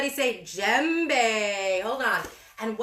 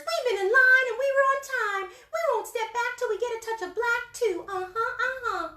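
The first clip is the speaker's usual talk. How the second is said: high, fast little bird voices; pushy little birds, high and quick; small birds; 12 semitones higher, faster than usual. Acted. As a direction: chirp out the demand quickly. Small birds insisting in line.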